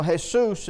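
"Jesus" said the Spanish way, hay-SOOS; Spanish pronunciation. Retold or imitated. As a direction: say "Jesus" with an H sound, stress on the second syllable.

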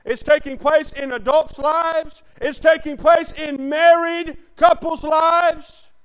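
loud, slow, hammering sermon delivery; full-force preaching. Acted. Preacher warning his congregation, preaching at full force. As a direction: preach it out to the back pew. Preacher driving home a point.

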